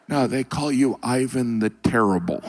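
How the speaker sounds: ordinary delivery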